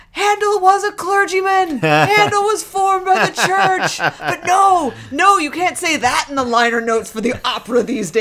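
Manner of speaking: loud, high-pitched imitation of an outraged rant; mock outrage. Boss back from the Handel opera, imitated as outraged. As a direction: shout it like a scandalized opera-goer. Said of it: "was just outraged".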